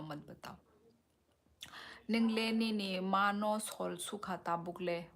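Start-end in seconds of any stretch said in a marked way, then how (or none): 0.0-0.6 fading mock sulk. Viewer refuses to tell the message.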